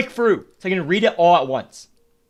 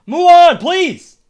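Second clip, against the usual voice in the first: higher, louder, fed-up plea; exasperated shout; 11 semitones higher, 7 dB louder, slower than usual. Real, not acted. Streamer yelling at the slow game text, fed-up shout. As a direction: yell at the slow text to hurry up.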